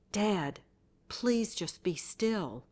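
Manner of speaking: worn-out exasperation, pressing each word; exasperated plea. A narrator's reading. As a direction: plead slowly and firmly, out of patience. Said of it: "He could hear the exasperation in my voice".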